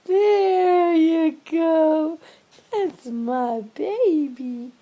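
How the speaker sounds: loud, long-drawn cheering coo; delighted pet praise. Real, not acted. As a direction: stretch every word out in proud delight. Delighted praise after cat yawns.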